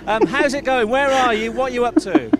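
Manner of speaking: ordinary talk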